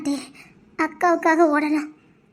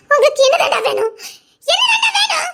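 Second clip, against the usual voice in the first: high, loud sobbing cry; crying shout; 9 semitones higher, 3 dB louder than usual. Acted. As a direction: break down screaming through tears. Crying outburst. (அழுகையுடன் கத்தல்)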